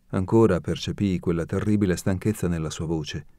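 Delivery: ordinary delivery, nothing marked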